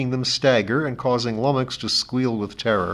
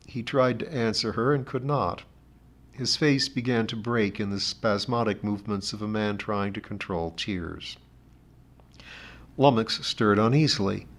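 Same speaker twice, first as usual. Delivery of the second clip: ordinary delivery, nothing marked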